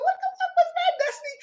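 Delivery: high, incredulous, what-the squeal; high falsetto of mock shock. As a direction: shriek it in disbelief. Retelling own shocked reaction.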